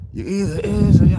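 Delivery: unfinished crooner imitation. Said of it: "there go Louis".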